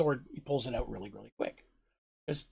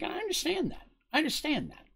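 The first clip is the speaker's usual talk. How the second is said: much higher, soft conceding repeat; placating; 12 semitones higher, 4 dB quieter than usual. Acted. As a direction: give ground gently. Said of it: "And Kip's like".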